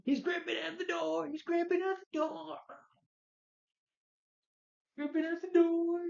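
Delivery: high shrill hammed-up yelling; mocking overacted line. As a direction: ham it up like terrible overacting. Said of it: "with awful dialogue from him".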